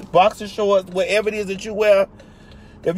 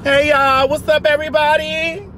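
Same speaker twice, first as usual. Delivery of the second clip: higher hype hello; vlog greeting; 7 semitones higher than usual. Real, not acted. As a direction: greet the viewers big and bright.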